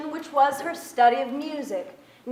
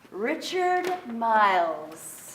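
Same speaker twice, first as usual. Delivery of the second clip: dreamy, lingering; much slower than usual. A narrator's reading. Narrator lingers over boy's name.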